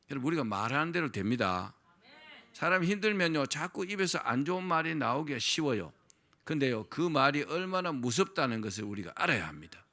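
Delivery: ordinary delivery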